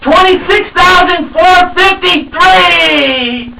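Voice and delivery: loud, high game show announcer; booming prize announcer. Retold or imitated. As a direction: belt out the prize like a TV host. Announces the car win.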